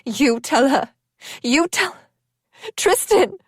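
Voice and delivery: desperate friend crying at the dying man. A narrator's reading. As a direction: beg him to stay alive. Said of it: "Tessa cried".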